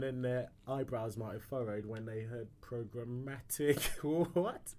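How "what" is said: ordinary delivery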